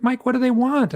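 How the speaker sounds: high-pitched, urgent imitation of the hypnotherapist; worried hypnotherapist. Hypnotherapist questioning her screaming client, high and urgent. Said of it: "poor Yvonne is like".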